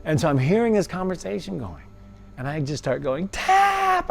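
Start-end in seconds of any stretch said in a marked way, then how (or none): 3.3-4.1 high, loud desperate yell; screaming. Retold or imitated. Begging the fighter to stop, screaming high and loud. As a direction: scream the word out in panic. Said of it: "I just start screaming".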